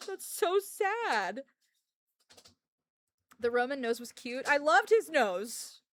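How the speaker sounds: slow, high, crying lament; near tears. Real, not acted. Fan mourning a character, crying it out slowly. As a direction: let the voice break with grief.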